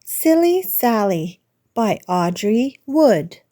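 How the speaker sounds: usual speaking voice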